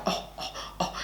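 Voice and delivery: hushed, breathy disapproving oh oh oh; quiet embarrassed scolding; disapproving tut. Retold or imitated. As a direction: whisper it like a scandalised boyfriend. Boyfriend disapproving of her.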